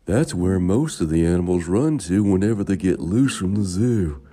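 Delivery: loud, high zookeeper voice; bored zookeeper voice. A narrator's reading.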